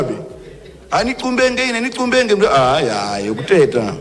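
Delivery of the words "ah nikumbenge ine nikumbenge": mocking high whine. Mimicking a complaining hoarder.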